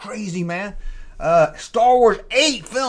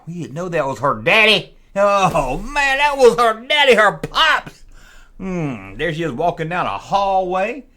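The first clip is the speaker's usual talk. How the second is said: usual speaking voice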